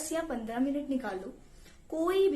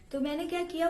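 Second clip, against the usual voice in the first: ordinary delivery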